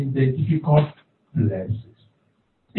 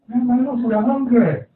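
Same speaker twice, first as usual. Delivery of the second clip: higher, playful lion voice; hungry lions; 8 semitones higher than usual. Acted. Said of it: "lions speaking".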